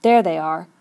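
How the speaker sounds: loud, mildly bitter statement. A narrator's reading. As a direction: point them out with a touch of bitterness. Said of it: "the bitterness of his voice".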